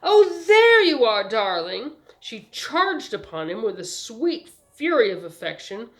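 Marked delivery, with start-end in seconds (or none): 0.0-2.0 high, loud affected greeting; gushing socialite calling out to her darling; artificial, attractive cry. A narrator's reading. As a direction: cry out with showy, put-on charm. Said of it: "somewhat artificial yet attractive voice, she cried out".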